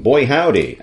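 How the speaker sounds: cowboy. Pretend. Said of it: "turn into a cowboy".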